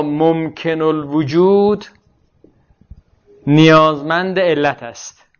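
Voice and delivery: slow dictation with drawn-out word endings; dictating. Real, not acted. Teacher writing an example proposition for students, slow dictation. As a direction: spell out the example slowly, pausing midway.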